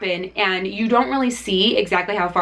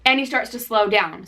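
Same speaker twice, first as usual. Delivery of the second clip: ordinary talk, nothing marked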